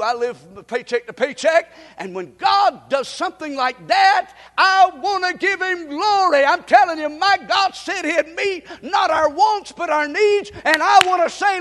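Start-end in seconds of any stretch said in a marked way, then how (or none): 2.0-11.6 loud, high, shouting praise; whooping preacher crying out praise; preaching at full force. Acted. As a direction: lift the congregation with a roaring testimony.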